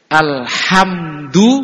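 Alhamdu stretched very slowly, the ha held long; drawn-out alhamdu. Shown as a demonstration. Teaching the congregation the ha in Alhamdu, slowly drawn out. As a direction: spell out Alhamdu syllable by syllable, slow. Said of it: "Itu kan pakai ha".

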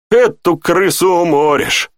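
dry amusement, stretched out; wry snort. A narrator's reading. Lieutenant jokes about the old innkeeper, wryly. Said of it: "хмыкнул Тьерри".

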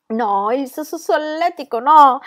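laughing stammer, being tickled. A narrator's reading. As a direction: squirm and giggle through it. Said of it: "convulso di riso".

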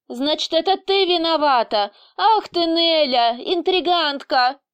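high, accusing cry; angry shout. A narrator's reading. Boss blames Nelya for the wish, angry shouting. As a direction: cry out the accusation, drawing out the insult. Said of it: "крикнул босс".

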